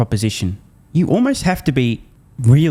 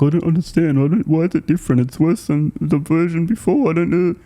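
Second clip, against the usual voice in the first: higher-pitched mock bewildered voice; clueless doubters; 7 semitones higher than usual. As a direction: imitate everyone else whining in confusion, pitched up. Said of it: "when everyone else was like".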